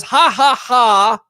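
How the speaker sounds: jeering laugh, read out; mock troll laugh. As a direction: ham up the troll's ha ha. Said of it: "It says".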